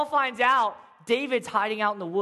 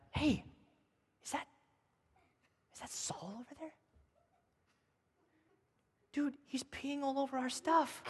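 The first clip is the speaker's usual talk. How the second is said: softer, hushed lookout voices; mock whisper, not caught; hushed friends; 5 semitones higher, 12 dB quieter than usual. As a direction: whisper it like you're hiding in a cave. Friends spotting Saul in cave.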